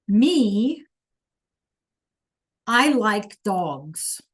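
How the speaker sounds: ME stressed, then I like dogs very slow; emphatic me. Shown as a demonstration. Teacher showing the emphasis to students. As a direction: lean on the me, then stretch it out. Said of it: "They're saying".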